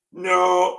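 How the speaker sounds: put-on retry the Swiss accent after being told it's wrong; Swiss accent, second try. Grandpa retries a Swiss accent after criticism. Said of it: "Attendez, l'accent suisse?".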